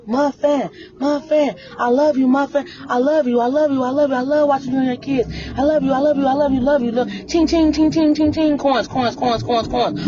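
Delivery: high, sing-song mocking fan voice, gushing love and coins. Mocking her gushing viewers in a high sing-song voice. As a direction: coo like a fawning fan, then chant ching ching and coins.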